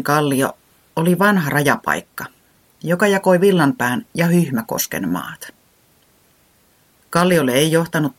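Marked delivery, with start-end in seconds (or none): none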